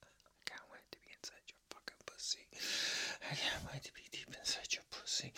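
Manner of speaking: soft, fast whisper in the ear; right in your ear. Acted. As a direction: lean in and whisper it close. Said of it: "let me whisper in your ear".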